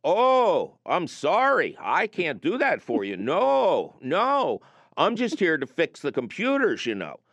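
sing-song Upper Midwest clerk, lightly done; mock Minnesota accent, cheerful and chatty. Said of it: "And I swear to God, no exaggeration".

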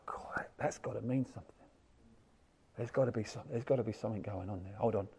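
hushed, awed inner voice; awed whisper. Retold or imitated. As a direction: whisper it as a dawning realization. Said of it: "you go".

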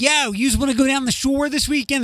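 put-on high-pitched Philadelphia accent; exaggerated Philly street greeting. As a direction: imitate a Philly local. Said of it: "in Philadelphia, it's like".

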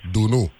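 Dunu ending in "noo", like canoe; pronunciation demo. Shown as a demonstration. Host relays the corrected name, Dunu ending like canoe. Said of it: "Like, like canoe".

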